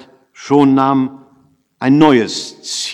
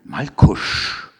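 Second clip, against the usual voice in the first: whispered name, gently drawn out; confidential, sympathetic whisper; friendly whisper; 3 semitones lower than usual. A narrator's reading. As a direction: whisper it kindly, with pity. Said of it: "flüsterte ich freundlich".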